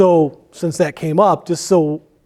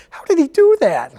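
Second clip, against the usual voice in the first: high-pitched imitation of baffled disbelief; amazed cops; 10 semitones higher than usual. Firefighter imitating cops amazed at his colleague, incredulous. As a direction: marvel as the onlookers would, pitched way up. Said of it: "the cops are like".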